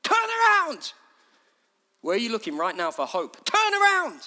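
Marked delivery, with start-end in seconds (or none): 0.0-0.9 high, urgent shout; sudden raised preacher's call. Acted. Preacher calls out as John. 3.4-4.2 high, urgent shout; sudden raised preacher's call. Acted. Preacher calls out as John.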